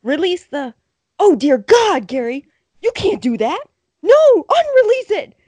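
high, loud play-by-play outcry; hammed-up horrified commentator; frantic announcer. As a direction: call it like a scandalised announcer. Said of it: "like he's an announcer".

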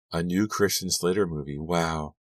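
plain reading voice

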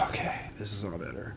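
sigh, then very soft words; soothed relief. Retold or imitated. Father holding his newborn for the first time, quietly relieved. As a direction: let the anger go in a hushed exhale.

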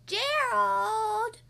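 high, loud call from Piggy. Acted. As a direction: shout his name to wake him. Piggy calls out to Gerald.

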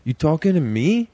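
Taxi Driver menace, rising questions; mock Travis Bickle confrontation; De Niro impression. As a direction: do a De Niro tough guy. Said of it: "Robert De Niro in Taxi Driver is like".

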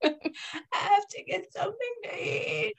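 high, softened mock whine; put-on old smoker pleading for food; old smoker impression. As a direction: mimic a child who sounds like an aged smoker. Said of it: "like, sixty-year-old smoker".

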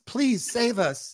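drawn-out pretend cry for rescue; mock fearful plea. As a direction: voice a hostage's silent plea, played for laughs. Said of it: "I see fear in their eyes".

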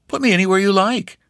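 breezy request for a bed; lightly voiced young hunter; easygoing young man. Stranger asks lodging.

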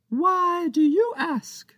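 tiny pirate captain sizing up Captain Joe's offer; intrigued tiny pirate. Acted. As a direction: draw it out with suspicious curiosity. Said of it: "Captain Big was intrigued".

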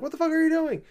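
high mock yell; imitated yelling guy. The forest guy yelling at the player, high mock yell. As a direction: squeal it up high like an outraged game character. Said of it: "the guy's yelling at you".

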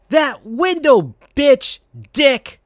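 mock cartoon rage. A narrator's reading. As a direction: spit out the insults in fury. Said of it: "felt a sudden rage build".

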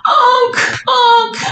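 high mock wail; distraught dog owner. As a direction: imitate the owner calling his dead dog. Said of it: "And he's like".

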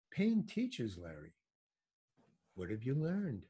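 soft, unhurried, quietly wise; very calm. Retold or imitated. Friend on the couch answering Larry's pain, soft calm wisdom. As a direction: deliver it softly like a serene teacher. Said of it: "very calmly".

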